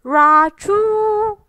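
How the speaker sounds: loud, high, drawn-out sneeze; a huge bear sneeze that freezes the crowd; big sneeze. A narrator's reading. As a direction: let the bear's sneeze ring out. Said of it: "He blows and he sneezes".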